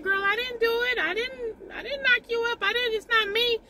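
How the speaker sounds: very high-pitched mock denial; comic squeaky-high disclaimer; joking protest. Jokingly denies causing the pregnancy.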